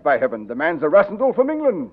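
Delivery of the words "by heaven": ordinary talk, nothing marked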